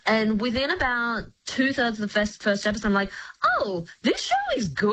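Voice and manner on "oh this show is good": high-pitched reenacted pleasant surprise; pleasantly surprised. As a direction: act out suddenly being won over, pitch leaping up. Acted surprise at liking the show.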